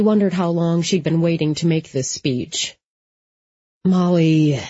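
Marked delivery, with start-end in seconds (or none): 3.8-4.6 weary appeal to a friend. A narrator's reading. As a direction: say the name with tired patience. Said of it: "he said wearily".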